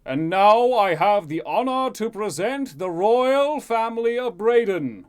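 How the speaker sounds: high, booming herald's proclamation; yelled announcement. A narrator's reading. Herald presenting the royal family to guests, yelled announcement. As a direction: proclaim it slowly and loudly to a whole hall. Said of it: "you hear someone yell out".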